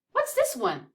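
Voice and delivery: ordinary talk, nothing marked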